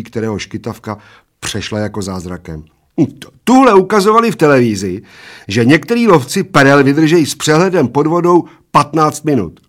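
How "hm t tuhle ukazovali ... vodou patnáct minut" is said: put-on high, loud little old man, stumbling in eagerly; excited little old man. A narrator's reading. Little old man cites television about pearl divers, high and eager. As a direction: rush in high and loud with the fact.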